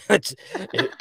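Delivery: ordinary delivery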